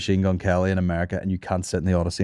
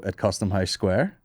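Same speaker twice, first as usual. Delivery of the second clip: ordinary talk, nothing marked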